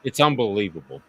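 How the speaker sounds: usual speaking voice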